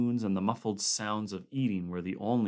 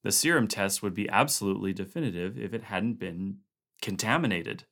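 usual speaking voice

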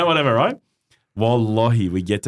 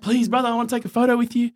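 eager biker fan; 13 semitones higher than usual. Retold or imitated. Biker begging for photo.

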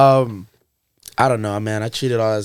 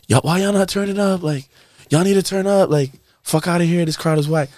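higher, heated hype-man yell; berating crowd; 7 semitones higher than usual. Retold or imitated. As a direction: scold a dead crowd.

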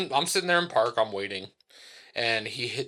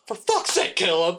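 high-pitched mock outburst; retold flipping out; 5 semitones higher, 4 dB louder than usual. Dad flipping out at his son, mock exasperation. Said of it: "he's just flipping his shit".

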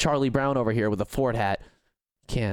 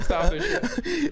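high pitched through a voice effect; laughing through a silly pitch filter. Pretend. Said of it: "This effect is the best thing".

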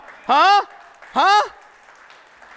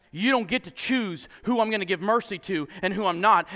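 normal talking voice

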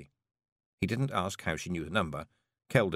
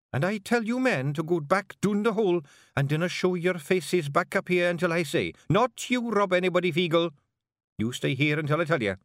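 raised, cutting order; sharp Kelder command; 10 semitones higher, 3 dB louder than usual. Acted. Jeanie orders the men down the hole, in a sharp cold voice. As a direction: cut the air like a dagger of ice. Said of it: "hard, cold, sharp".

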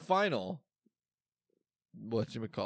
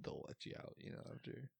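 whispered aside; 17 dB quieter than usual. Real, not acted. Aside about jail.

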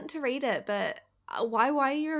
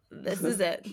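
low drowsy morning voice; sleepy morning voice; 3 semitones lower, slower than usual. Real, not acted. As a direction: sound fresh out of bed. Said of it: "what I sound like first thing in the morning".

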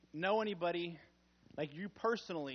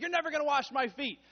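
1 over-eager Peter; 5 semitones higher, 4 dB louder than usual. Retold or imitated. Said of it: "and Peter's like".

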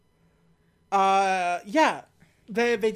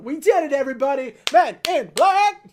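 mock victory cheer; 6 semitones higher, 8 dB louder than usual. The agents celebrate their win, loud and rising. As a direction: cheer it to the troops. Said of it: "They're like".

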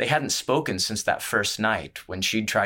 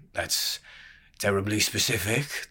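drawled. A narrator's reading. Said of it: "the barkeep drawled".

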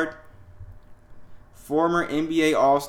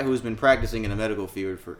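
ordinary delivery

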